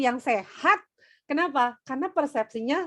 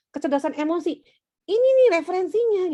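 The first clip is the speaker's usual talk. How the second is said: excited; 8 semitones higher, 5 dB louder than usual. Retold or imitated. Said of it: "ketika kita bilang".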